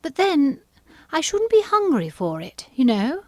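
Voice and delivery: high-pitched, musing Alice; thoughtful. A narrator's reading. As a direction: think it over aloud in a high voice. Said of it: "said Alice thoughtfully".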